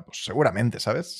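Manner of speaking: ordinary talk, nothing marked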